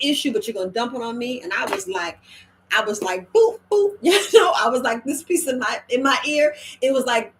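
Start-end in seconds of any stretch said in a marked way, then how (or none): 3.3-4.0 high, loud mock beeps; playful beeping noise. Telling the story of words that hit her ear, with high mock beeps. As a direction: beep it out like an alarm.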